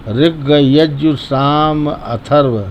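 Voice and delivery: each name "ऋग यजु साम Atharva" said very slowly, separated; slow and clear. Shown as a demonstration. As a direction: spell out the four Vedas slowly. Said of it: "स्पष्ट बोलेंगे". (बहुत धीरे, स्पष्ट उच्चारण)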